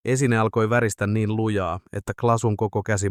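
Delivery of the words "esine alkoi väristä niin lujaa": ordinary delivery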